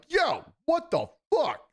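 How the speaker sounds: high, drawn-out mock yell; retold shout. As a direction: call out sharply high, stretching each word. Retelling his shout at the choker.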